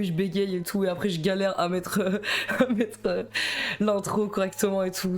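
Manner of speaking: ordinary talk, nothing marked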